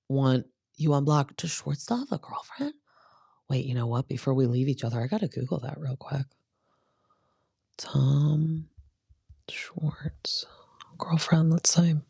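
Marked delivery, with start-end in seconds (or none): 1.4-2.7 whisper. Real, not acted. Conspiratorial gossip question. 7.8-11.9 whisper. Real, not acted. Typing a search under her breath.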